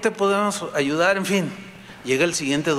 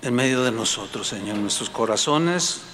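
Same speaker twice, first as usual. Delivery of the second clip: closing prayer; 4 semitones lower than usual. Acted. Pastor leading the congregation in prayer, humble and earnest. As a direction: pray humbly to God, dropping at each Señor.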